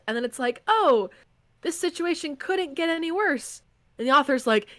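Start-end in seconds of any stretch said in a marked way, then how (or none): 0.7-3.6 high, light mock-innocent voice; high mock-naive reader. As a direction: sound sure nothing worse can come. Reader voiced, author replies.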